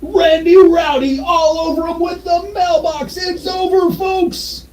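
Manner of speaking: high, loud, slow wrestling commentator yell; play-by-play announcer. Pretend. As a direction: call the top rope finish to the crowd. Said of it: "My play-by-play kicked in".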